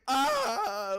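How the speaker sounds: high, loud startled yelp; mock frightened cry. She answers the yelling driver with a loud scared yelp. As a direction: let out a yelp like a scared kid. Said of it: "I was like".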